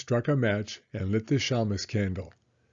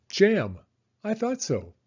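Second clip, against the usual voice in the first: high, loud eager exclamation; excited guess; 9 semitones higher, 3 dB louder than usual. Acted. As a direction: blurt it out delighted. Baba Brena guessing his choice.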